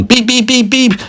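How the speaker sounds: high-pitched frantic honking; quick squeaky beeps; car horn beeps. Acted. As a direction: imitate an angry driver's horn. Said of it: "beeping his horn".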